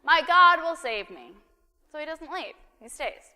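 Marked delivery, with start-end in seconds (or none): 0.0-1.4 loud, high, insistent refusal; stubborn flood victim refusing help, loud and high; stubborn believer. Acted. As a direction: cry out no like nothing could move him. Stubborn man repeated.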